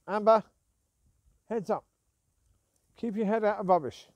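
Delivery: loud call across the park; calling the dog. Real, not acted. Owner calls out to her dog Amber, loud call. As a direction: call her name out loud to get her attention.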